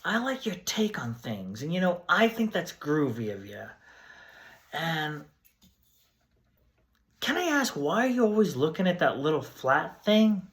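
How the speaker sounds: spaced-out hippie asking questions; groovy hippie. Acted. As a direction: play a mellow, puzzled flower child. Plays groovy time-traveling guest.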